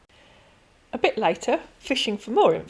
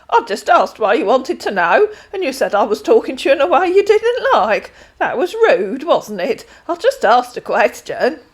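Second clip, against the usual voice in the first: put-on chatty elderly lady, louder than the narration; a kindly pensioner rambling on; old lady Denise; 5 semitones higher, 9 dB louder than usual. Voices Denise.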